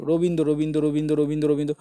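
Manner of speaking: Rabindra rattled off repeatedly on a level pitch; test repetition. Shown as a demonstration. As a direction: repeat Rabindra in an even, running chant. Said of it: "অন্য কোনো কিছু উচ্চারণ আসছে?".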